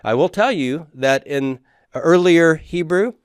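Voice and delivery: usual speaking voice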